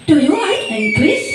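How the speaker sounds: put-on English with a Javanese accent, slow and stressed; teasing students in a Javanese accent. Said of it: "dialek Jawa ya".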